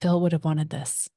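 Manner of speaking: solemn mock-tragic eulogy line; dramatic reading. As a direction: deliver it like a grieving widow at the funeral. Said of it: "I want a dramatic reading".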